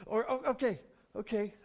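ordinary talk, nothing marked

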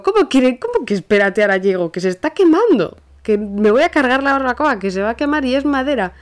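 high and loud, disbelieving outrage; exasperated shout. Retold or imitated. Telling her father he can't just wait, exasperated high shout. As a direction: shout him down, frantic.

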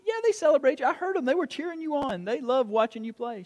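put-on high-pitched protest to a child; retold parent cheering a child up; insistent reassurance.

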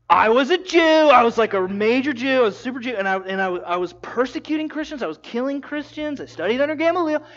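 loud, high proud boast; excited boast. Acted. Paul tells Agrippa how zealous he once was, excited and loud. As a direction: brag it, building fast.

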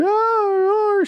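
very high, drawn-out hammed-up shriek; overdramatic mock wail. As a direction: imitate a dramatic actress wailing in horror. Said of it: "She's so dramatic".

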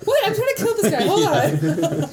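笑いながら叫ぶ; laughing mock yell.

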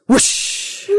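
breathy wind whoosh. Acted. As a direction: make the rush of wind with breath. Sound effect in read story.

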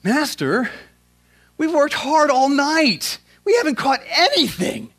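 high, exasperated sarcasm, drawn out; worn-out disciple's sarcastic complaint; sarcastic Peter. Acted. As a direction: protest sarcastically with pitch leaping up. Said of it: "hear the sarcasm in Peter's voice".

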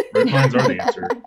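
high laughing joke. Real, not acted. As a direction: laugh through the punchline. Joking candy is the answer.

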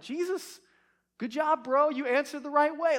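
hammed-up pat on the back; mock congratulation. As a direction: imitate a buddy patronizing someone. Jokingly acts out a wrong reaction.

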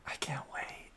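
hushed playful whisper. Pretend. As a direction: whisper it teasingly. Whispered banter about the finale.

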